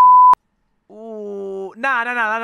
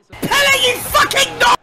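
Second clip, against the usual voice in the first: loud, much higher angry yell; furious rant; 7 semitones higher, 4 dB louder than usual. Real, not acted. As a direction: explode at the screen. Raging at conceded goal.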